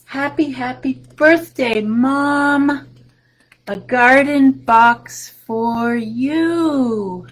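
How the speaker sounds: ordinary delivery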